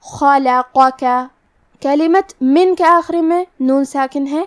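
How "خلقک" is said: خلقک slow as kha-la-qa-ka with four equal vowels; even fathas. Shown as a demonstration. Teacher demonstrates equal vowels in خلقک. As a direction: give each zabar in خلقک the same length. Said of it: "چاروں زبر کو برابر سے ادا کرنا ہے".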